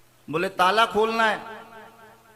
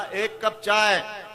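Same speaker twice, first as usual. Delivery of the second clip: slow, dragged "एक cup चाय"; weary request; much slower than usual. Acted. Tired husband comes home and asks his wife for tea, slowly. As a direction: ask for tea as if worn out by the day.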